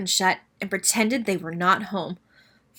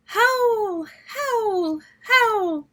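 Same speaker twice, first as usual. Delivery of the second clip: high wailing howls; high whooping wind howls; howling wind; 12 semitones higher, much slower than usual. A narrator's reading. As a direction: howl like the wind. Wind noise in story.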